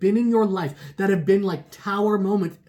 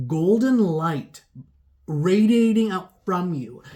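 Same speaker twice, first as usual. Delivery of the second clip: slow dreamy telling; slower than usual. Acted. Tarot reader painting a vision.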